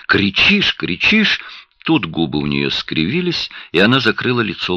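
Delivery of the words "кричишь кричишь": high-pitched, heated repeated accusation; worked up. A narrator's reading. Wife accuses her husband of yelling, worked up and high. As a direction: throw it back at him, pitch jumping right up. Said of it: "завелась Рэчел".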